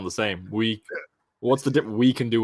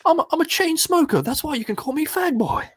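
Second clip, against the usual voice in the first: much higher and louder squeaky monster; squeaky Venom voice; 5 dB louder than usual. Acted. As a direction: go shrill and silly as the monster. Continues silly Venom voice.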